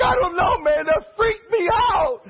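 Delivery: high, loud, near-crying shout; rattled, high-pitched yelling; freaked-out yell. Retold or imitated. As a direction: blurt it out high and panicky. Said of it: "I was like".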